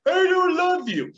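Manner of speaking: put-on high, loud, slow child voice; sulky kid declaring it, slowly; child's hurtful words. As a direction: say it like a defiant little kid. Child rejecting the teacher again.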